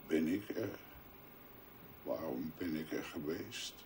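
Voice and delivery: a quiet, despairing voice about emptiness and hell; hushed dread. Acted. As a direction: murmur into the void. Dark hushed monologue about void and hell.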